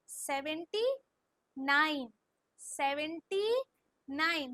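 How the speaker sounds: Seventy drawn out, then falling nine; very slow dictation. Shown as a demonstration. Teacher tells pupils to say it slowly, drawn-out model. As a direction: stretch seventy, pause before nine. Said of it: "slow slow बोलो".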